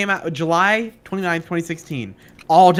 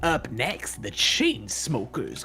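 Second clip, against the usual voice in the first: radio DJ voice; 5 semitones lower, 8 dB quieter, slower than usual. Pretend. Radio DJ bit.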